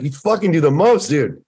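high, loud gushing praise; excited hype. Retold or imitated. Wes tells his friend he does the most, excited and loud. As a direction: shout it like the final punchline of a hype speech. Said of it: "He's like".

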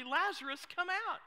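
loud, high call; commanding declaration. Acted. Jesus calls Lazarus out of the tomb, loud declaration. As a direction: call it out as a simple, forceful command. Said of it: "he declares quite simply".

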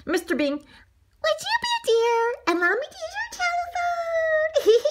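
very high, sugary polite request; extra polite. A narrator's reading. Donut asks to borrow the telephone, extra politely. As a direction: ask in an exaggeratedly sweet high voice. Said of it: "Arnie asked extra politely".

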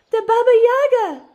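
shrill, very high, loud shriek; terrified scream. A narrator's reading. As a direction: scream as the monster bursts out. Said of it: "the crowd screamed".

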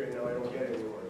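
ordinary talk, nothing marked

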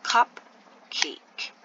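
cupcake said slowly as CUP, then CAKE; syllable by syllable. Shown as a demonstration. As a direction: split cupcake into two separate beats. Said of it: "let's take our time and say".

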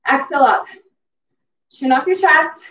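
normal talking voice

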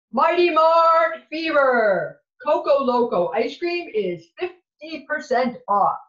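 loud hyped-up shop announcement; store announcer. Acted. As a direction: blast it like a store PA. Announcer on the microphone.

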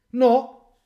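loud, high, bratty "No!"; defiant shout. Retold or imitated. Son refusing his father, a loud bratty shout. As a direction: snap back like a defiant child.

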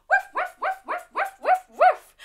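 high yappy woofing like a space dog; playful puppy woofs for the kids. Acted. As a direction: bark it out high and bouncy. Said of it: "let's have a Mutnik woof".

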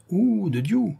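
high-pitched oath of anger; indignant swearing outburst; outraged exclamation. Acted. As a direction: cry out, stung by an insult. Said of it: "s'écria l'homme".

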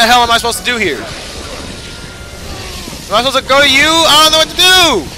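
frustrated yell. Real, not acted. Gamer lost in the boss fight, frustrated yelling. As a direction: yell out confused and helpless.

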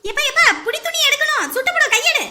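alarmed warning. Acted. Mother warns son of hot pot.

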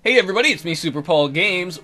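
ordinary delivery

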